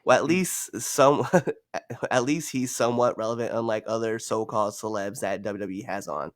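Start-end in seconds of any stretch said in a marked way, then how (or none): none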